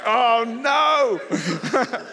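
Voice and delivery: loud, high mock wail; comic dismay. Preacher replays seeing the cafe empty, comic dismayed shout. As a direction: cry out in hammed-up horror.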